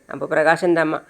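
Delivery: plain reading voice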